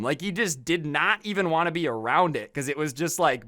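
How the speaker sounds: ordinary delivery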